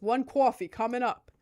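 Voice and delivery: loud, hammed-up New York barista; mock New York accent. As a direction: play a brash New York counter clerk for laughs. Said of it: "thought it was really funny to be like".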